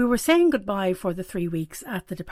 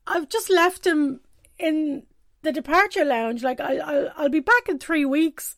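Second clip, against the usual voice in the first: tearful explanation; 7 semitones higher than usual. Retold or imitated. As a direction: get the words out between tears. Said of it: "I was like".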